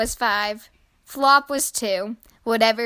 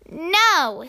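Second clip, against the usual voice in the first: put-on higher, childlike no; lightly voiced sibling protest; mild refusal; 6 semitones higher than usual. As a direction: refuse like an annoyed big brother. Older brother refuses little brother.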